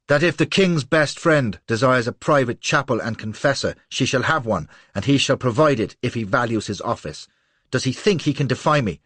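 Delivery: loud, imperious threat; contemptuous rage. A narrator's reading. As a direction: lay down the law with scorn. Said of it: "husky voice charged with contempt".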